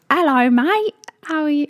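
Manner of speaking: high, loud mock-British greeting; amped-up British accent. Friend playing up Britishness to a bouncer, amped-up British accent. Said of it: "like a really posh British accent".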